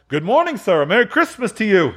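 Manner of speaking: high, cheery holiday hail; good-humored greeting. Acted. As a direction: call out a high-pitched merry greeting. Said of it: "three or four good-humored fellows said".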